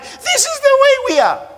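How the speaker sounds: high, imitating a crying woman; mock crying plea. Preacher imitating a crying woman on TV, high and tearful. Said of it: "And she's crying".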